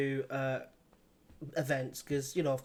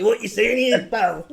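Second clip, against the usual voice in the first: loud, raised-pitch mock-angry Scottish caller; angry Scotsman; 6 semitones higher, 8 dB louder than usual. Host imagines a Scotsman's voicemail, mock angry Scottish voice. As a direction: play an irate Scotsman confronting someone, for laughs. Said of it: "angry voicemail from a Scotsman".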